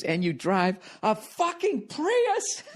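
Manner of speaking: high, drawn-out mock yell; high-pitched mock outraged heckle; imitated yelling woman. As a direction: screech it slowly in falsetto, without real volume. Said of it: "at this point she was yelling at me".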